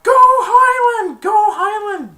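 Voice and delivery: high, drawn-out shouted chant; victory cheer. Retold or imitated. Dizzy after Highland wins, long screamed cheer. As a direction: cheer it at full volume.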